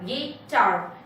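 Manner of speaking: "Guitar" broken into गि and टार; syllable by syllable. Shown as a demonstration. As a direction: separate both beats of "guitar" with a gap. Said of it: "So two syllables". ("Guitar" को दो टुकड़ों में)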